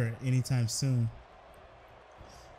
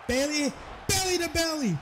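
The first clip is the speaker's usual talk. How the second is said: excited shout; 12 semitones higher than usual. Real, not acted. Commentator calling the belly to belly, very high excited shout. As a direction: scream the move name with the crowd. Commentator calls the big suplex.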